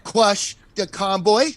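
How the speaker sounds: high, broken-English put-on accent; mocking the chief in a put-on Chinese accent. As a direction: ham up a broken-English villain. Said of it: "the whole Chinese voice".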